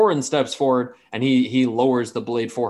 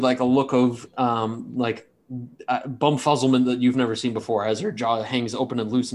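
ordinary talk

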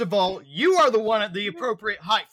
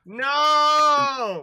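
dismayed cry; 6 semitones higher, 6 dB louder than usual. Real, not acted. Car falls toward water.

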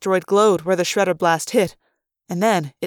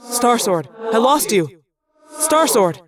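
urgent radio call; 3 semitones higher, 3 dB louder than usual. A narrator's reading. Pilot calling back after losing contact.

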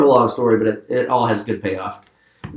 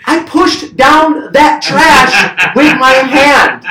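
outraged complaint; 13 semitones higher, 8 dB louder, much slower than usual. Retold or imitated. Brother quoted, outraged.

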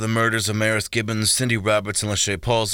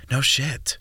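gasped; 4 semitones higher than usual. Acted. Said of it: "Jordan gasped".

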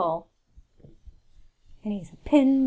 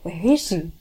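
normal talking voice

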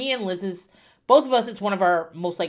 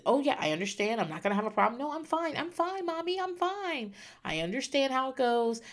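imitating her son; 6 semitones higher, 6 dB quieter than usual. Child pretends calm before shot.

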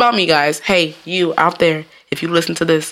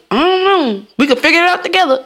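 acted admission; 10 semitones higher than usual. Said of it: "I'm gonna say".

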